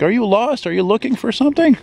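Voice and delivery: high mock little-lady voice in French; high imitated lady asking in French; imitating old lady. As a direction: put on the little old lady's voice. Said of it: "this little lady walking behind me".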